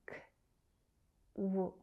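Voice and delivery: "k, w" as separate sounds, kuh... wuh; isolated consonants. Shown as a demonstration. Teacher splitting Q U into sounds, "k, w" said bare. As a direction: say "k" and "w" apart, a pause between. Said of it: "It's the consonants".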